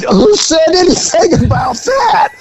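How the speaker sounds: high, pointed mock question; spring the trap on him; incredulous cop. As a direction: cop catching the suspect in a lie. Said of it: "I said".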